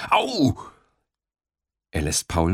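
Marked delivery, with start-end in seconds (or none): 0.1-0.6 sharp high yelp; a big boy yelping when hit; cry of pain. Acted. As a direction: yelp as if struck hard. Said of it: "der Große ruft".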